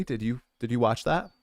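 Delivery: ordinary delivery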